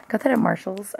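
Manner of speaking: usual speaking voice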